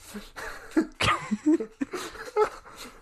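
high squealing laugh. Real, not acted. Laughing at the mixed-up name.

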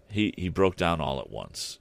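plain reading voice

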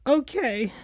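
small, high falsetto reply; meek, downcast squeak; sad and quiet. A narrator's reading. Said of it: "in a sad and quiet way".

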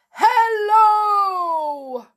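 huge, high, loud booming hello; giant's booming hello. Acted. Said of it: "send them running for the hills".

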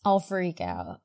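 ordinary delivery

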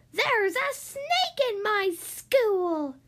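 high, loud, slow bellow of outrage; furious headmistress shouting at the whole playground; outraged shout. A narrator's reading. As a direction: headmistress roaring across the playground. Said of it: "she shouted".

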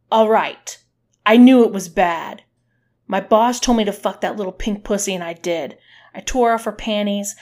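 loud, forceful admission; heated confession. A narrator's reading. Man confessing to the woman punishing him, heated. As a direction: blurt it out hard, starting very loud.

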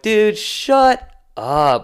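slow, high, fed-up "dude, shut up"; exasperated dismissal. Retold or imitated. Fan snapping back at national media, slow and high. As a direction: drag it out like you can't stand hearing it. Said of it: "I'm like".

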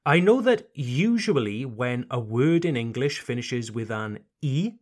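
usual speaking voice

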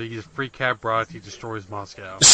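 usual speaking voice

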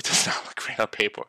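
fast laughing whisper aside. Real, not acted. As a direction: whisper it with a chuckle.

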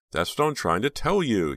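high, loud exasperation; frustrated, emphatic retort; impatient. A narrator's reading. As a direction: insist impatiently to someone who interrupted. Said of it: "Jade said impatiently".

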